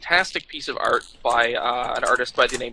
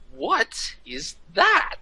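very slow, hushed, higher-pitched disbelief; mock incredulity; 8 semitones higher, 4 dB quieter, much slower than usual. Actor staring at the picture he must sign, incredulous. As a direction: draw each word right out, high and soft. Retold signer reacts to picture.

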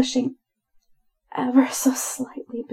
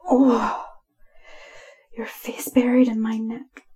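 plain reading voice

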